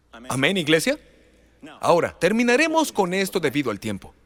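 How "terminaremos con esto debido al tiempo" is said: usual speaking voice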